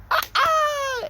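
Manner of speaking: put-on very high, loud delighted baby squeal; happy baby squeals. Kids squealing with joy over chocolate broccoli, very high. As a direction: squeal like a thrilled toddler. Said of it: "they would go".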